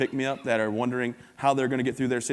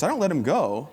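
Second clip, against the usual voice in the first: normal talking voice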